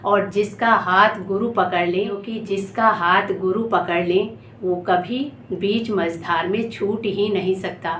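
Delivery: ordinary delivery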